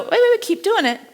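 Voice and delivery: excited interruption. Real, not acted. Stopping class mid-exercise.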